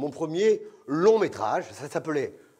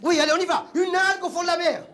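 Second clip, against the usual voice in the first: much higher, louder push; impatient director; 11 semitones higher, 4 dB louder than usual. Retold or imitated. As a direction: egg him on shrilly. (directeur impatient, très aigu)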